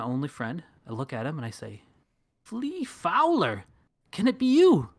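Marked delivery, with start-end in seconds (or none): 2.4-4.9 can't believe it's him; amazed. Acted. Das recognizing his old friend Flea, amazed.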